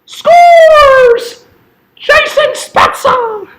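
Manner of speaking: screaming high and loud as the tying goal goes in; ecstatic goal yell. Real, not acted. Fan roaring at the screen for the tying goal, ecstatic yell. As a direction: let it rip, full-throated goal call.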